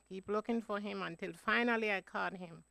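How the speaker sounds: usual speaking voice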